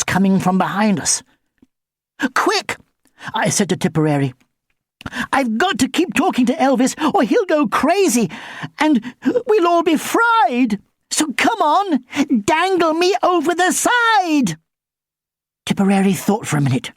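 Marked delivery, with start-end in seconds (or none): 2.3-2.8 sudden high urgent call; urgent cry. Acted. 5.3-14.6 rising, higher and more desperate; urgent pleading. Acted. Pleading urgently amid danger.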